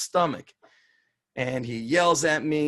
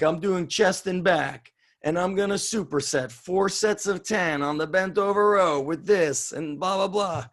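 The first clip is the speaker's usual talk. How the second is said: higher-pitched showy announcement to the room; loud announcement; 5 semitones higher than usual. Retold or imitated. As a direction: announce it so everyone can hear. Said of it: "I announce loud, like, people could try to hear me".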